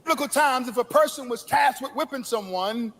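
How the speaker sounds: high, ringing sermon delivery; full-force preaching. Acted. Preacher warning the congregation of dangerous times, ringing. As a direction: preach the last days with fire.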